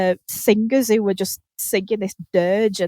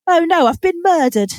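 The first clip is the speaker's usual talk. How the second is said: higher, louder mock-surprised oh no; mock dismayed contestant; 7 semitones higher, 4 dB louder than usual. Contestant learning she's been murdered, mock dismay. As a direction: play a contestant shocked at being murdered.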